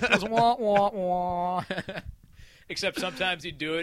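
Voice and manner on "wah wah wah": high, loud mock wah-wah sound effect; imitated sound effect. Imitating the dealer's bust sound for the podcast, loud and high. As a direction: play the dealer's sad trombone bust noise. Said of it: "he's making all these sound effects".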